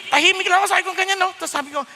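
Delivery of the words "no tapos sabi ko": ordinary talk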